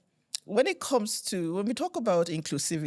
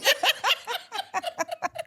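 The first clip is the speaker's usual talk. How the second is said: a high burst of real laughter; delighted high laugh. Real, not acted. Laughs after teasing remark.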